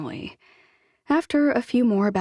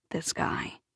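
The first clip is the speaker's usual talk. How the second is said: ordinary delivery, nothing marked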